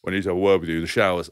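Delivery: low-pitched, quick teammate impersonation; Jono. Retold or imitated. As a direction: mimic a stern summons to a private word. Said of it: "this is how he sounds".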